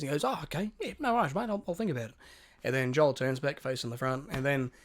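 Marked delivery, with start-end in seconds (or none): 0.2-2.1 higher-pitched, placating brush-off; mild mock agreeableness. Passenger fobbing off a chatty stranger on a bus, placating. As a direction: humour him politely in a higher voice.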